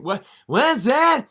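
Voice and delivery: high, loud yelling Dylan; hammed-up high Dylan shout; whiny [name]. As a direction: shout it like an eager young Dylan. Dylan mishears.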